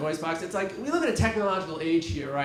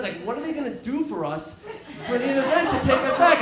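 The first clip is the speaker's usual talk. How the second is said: normal talking voice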